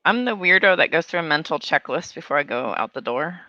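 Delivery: ordinary delivery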